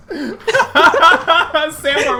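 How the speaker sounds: loud, high laughing question. Real, not acted. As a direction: laugh through handing over the turn. GM laughing after reversing turn order.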